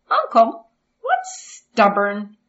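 put-on high bright child voice; curious young boy asking; eager little boy. Boy asks uncle.

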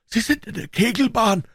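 high, anguished urgency; desperate stranger. Acted. Stranger rushes through the bushes, high despairing cry.

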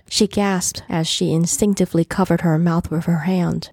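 plain reading voice

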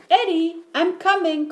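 high call into the distance. A narrator's reading. Mom answers Eddie's cry, calling out. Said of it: "called Mom".

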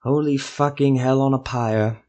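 usual speaking voice

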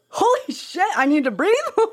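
high-pitched amazed outburst breaking into laughter; laughing, high-voiced astonishment; amazed realization. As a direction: squeal it in surprise, laughing by the end. Mock amazement at breathing's effect.

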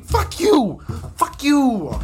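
high, shouted exasperation; defiant outburst. Real, not acted. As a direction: yell it back defiantly. Defiant outburst after admitting overreacting.

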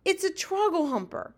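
high-pitched, furious outcry; mild shout of fury and anguish. A narrator's reading. Giant identifies a nightmare, furious anguished cry. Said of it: "he shouted. His voice was filled with fury and anguish".

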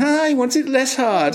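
mock them laughing at you; mocking masseuses. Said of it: "they're laughing at me, going".

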